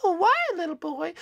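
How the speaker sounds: high, sweet falsetto question; flattered store lady. Acted. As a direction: ask expectantly, charmed by the boy. Lady awaits compliment.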